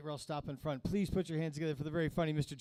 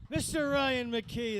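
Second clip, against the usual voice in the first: higher, louder, slow drawn-out name; hype announcement; 8 semitones higher, 7 dB louder, slower than usual. Real, not acted. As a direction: stretch the name like a ring announcer. Host hyping comic's name.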